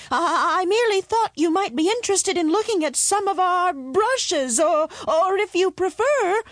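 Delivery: high, stammering timid voice; meek and terrified. A narrator's reading. Eldest son placates the roaring dragon, meek and frightened. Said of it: "the eldest son said meekly".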